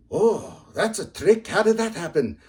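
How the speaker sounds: Murphy gasping in disbelief at Finnegan's boast; shocked Irish voice. Retold or imitated. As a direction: squeal it like an astonished pub mate. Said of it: "Murphy's shocked".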